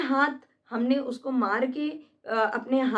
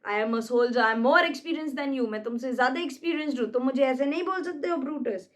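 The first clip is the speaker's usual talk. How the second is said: ordinary delivery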